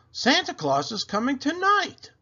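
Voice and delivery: high, loud excited news; excited child. Acted. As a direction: announce it like an excited kid. Amy calling at door.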